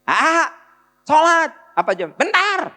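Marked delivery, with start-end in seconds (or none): 0.0-1.6 put-on high, loud shout at the kid; mother yelling. Mother shouting at her child to go pray. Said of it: "kalau ibu teriak". 2.2-2.7 high-pitched shouted retort; child yelling back. Retold or imitated. Child shouting back at mother, high. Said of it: "anak jawabnya teriak".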